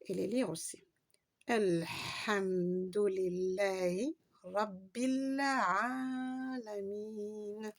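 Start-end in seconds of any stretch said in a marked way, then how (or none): 1.5-7.7 Elhamdulillahi Rabbil Alamin very slowly, long vowels held. Shown as a demonstration. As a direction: hold every long vowel. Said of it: "voyelles longues". (voyelles longues bien tenues)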